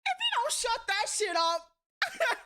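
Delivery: high, loud, slow, laughing through it; sassy comeback cracking up; laughing mock threat. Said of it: "And then I'm like".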